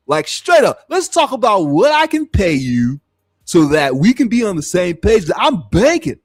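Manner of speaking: hammed-up mock of a rich guy bragging to Corey; Vlad impersonation. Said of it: "Let me see if I can do a Vlad impersonation".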